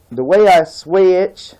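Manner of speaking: slow, loud Southern country accent; high, slow Tennessee accent. Shown as a demonstration. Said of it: "I can snap right back into it".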